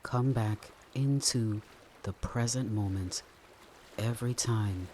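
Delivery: unhurried calm guidance with long pauses; guided meditation. Acted.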